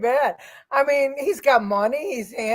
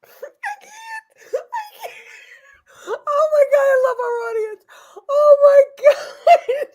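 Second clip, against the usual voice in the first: real high, loud, near-crying laughter; words squeal out through uncontrollable laughing; helpless laughter; 12 semitones higher, 4 dB louder than usual. Real, not acted. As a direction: gasp it out, cracking up. Helpless laughter at the audience's joke.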